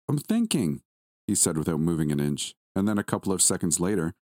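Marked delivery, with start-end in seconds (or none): 0.1-0.8 put-on high, thoughtful small child; lightly voiced boy pondering; little boy voice. A narrator's reading.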